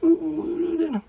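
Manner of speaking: high, sobbing mock reply; imitating crying. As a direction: sob it out like a crying child. Crying girl quoted.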